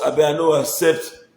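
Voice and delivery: normal talking voice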